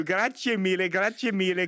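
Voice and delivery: ordinary talk, nothing marked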